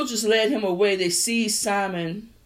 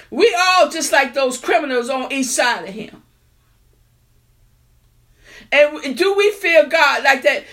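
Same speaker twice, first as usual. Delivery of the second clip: louder, rising sermon voice; fervent preaching; 5 semitones higher, 5 dB louder than usual. Acted. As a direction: preach it to the back pews. Preaching to congregation.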